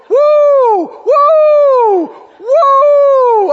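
long high loud whoop; hollered woo. Retold or imitated. Minister mimicking Brother Hagin shaking the car seat, hollered woo. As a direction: holler a sustained, piercing woo. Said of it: "And him hollering".

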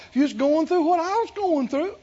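usual speaking voice

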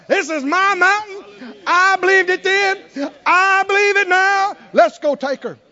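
put-on high, loud old man's vow; old Caleb. Eighty-year-old Caleb to Israel, loud and high. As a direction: claim the mountain like a fierce old warrior. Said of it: "they're still going".

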